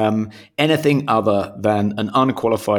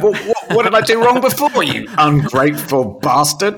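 high, loud indignant protest; mock outrage; 10 semitones higher, 5 dB louder than usual. Co-host playing the host offended by faint praise, mock outrage. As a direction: burst out high and fast, affronted.

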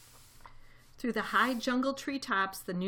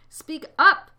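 loud, higher call to a faint voice; 8 semitones higher, 15 dB louder than usual. A narrator's reading. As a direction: raise your voice sharply on UP. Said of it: "said Horton".

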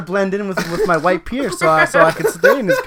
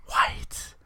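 whispered echo; 9 dB quieter than usual. Pretend. Echoing the whispered headline.

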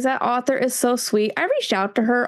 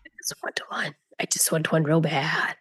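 softer, lower, yearning; wistful longing; 4 semitones lower, 5 dB quieter than usual. Real, not acted. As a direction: sigh it out like a wish. Longing for a tea dragon.

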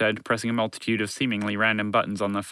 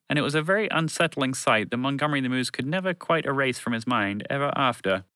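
usual speaking voice

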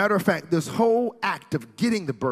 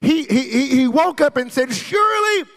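loud, high proclamation; full-force preaching; 11 semitones higher, 8 dB louder than usual. Real, not acted. Preacher telling how Jacob woke up amazed, full-force preaching. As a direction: build to a ringing sermon cry.

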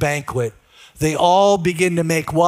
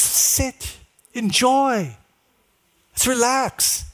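hushed, slightly higher, spaced-out words; soothing partner; 3 semitones higher, 3 dB quieter, much slower than usual. Retold or imitated. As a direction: imitate a friend gently urging calm. Partner urges him to slow down.

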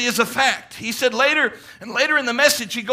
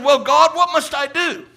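loud, higher plea to God; crying out to God; 4 semitones higher, 4 dB louder than usual. Retold or imitated. Branham pleading with God in the vision, loud high cry. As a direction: call up to heaven for help.

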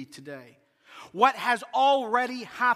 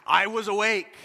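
excited; 3 semitones higher, 4 dB louder, slower than usual. Retold or imitated. Retold shock of pregnancy news.

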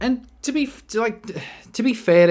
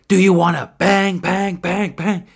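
loud, higher, punched repeated BANG; mock pestering shout; 6 semitones higher, 9 dB louder than usual. Prankster pestering beachgoers with a drink, mock shouting. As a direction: badger them with an imitated raised voice. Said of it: "he'd go".

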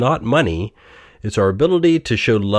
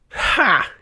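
single high "ha"; Scrooge; 11 semitones higher than usual. A narrator's reading. Old miser wakes in his own bed, high acted laugh. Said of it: "he laughed".

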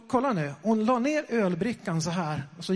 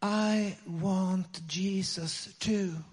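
very slow, drawn-out English; voice from behind; 4 dB quieter, much slower than usual. Retold or imitated. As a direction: imitate a hesitant stranger, stretching each word. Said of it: "så hör vi en röst bakom oss".

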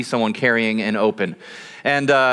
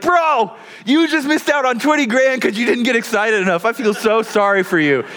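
high, worked-up incredulous friend; Matt; 9 semitones higher than usual. Retold or imitated. Pastor friend hearing about the missed money, high exclaiming dismay. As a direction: imitate an outraged buddy, pitched way up.

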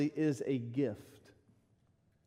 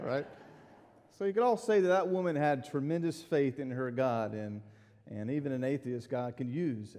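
normal talking voice

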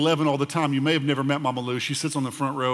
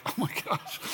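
hushed mock-aghast reaction; stunned whisper; 4 semitones higher, 7 dB quieter than usual. Pastor reacting to her hospital story, stunned whisper. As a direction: whisper it as if floored by the news.

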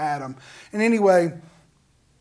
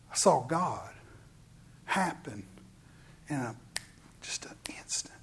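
hushed awe; 6 semitones lower, 13 dB quieter than usual. Real, not acted. Preacher recalling seeing God, sinking to a whisper. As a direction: drop to a hush, fading to a whisper.

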